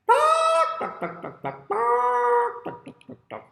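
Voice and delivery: loud high squawk dropping into quick clucks; clucking hen. Acted. Miss Hen calls out over her eggs, squawking and clucking. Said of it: "and she says".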